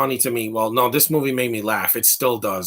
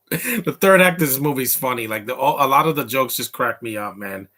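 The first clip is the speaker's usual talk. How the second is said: usual speaking voice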